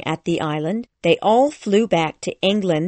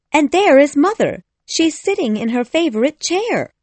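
put-on high, excited girl's call; Wendy crying out; 8 semitones higher, 4 dB louder than usual. A narrator's reading. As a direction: call it out in a much higher girlish voice. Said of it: "Wendy cried".

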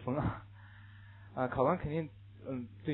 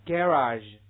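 "garage" slow, stress on the first syllable, GA-rage; 英式读法，慢读; British pronunciation; 9 dB louder than usual. Shown as a demonstration. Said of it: "这个英式读法".

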